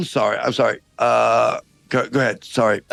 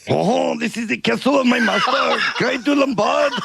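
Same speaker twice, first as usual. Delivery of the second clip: put-on French accent. French guard calling down from the castle wall, hammed-up French accent. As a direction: ham up a silly French guard. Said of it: "this outrageous accent".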